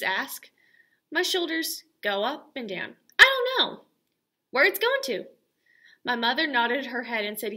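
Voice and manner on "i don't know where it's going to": put-on much higher, louder little girl; child worried about the bus, high and loud; worried kid. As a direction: blurt it out unsure. Child answers teacher, anxious.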